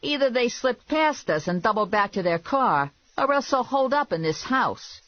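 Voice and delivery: high-pitched character voice; griping searcher. A narrator's reading. Man searching for the rabbit, griping.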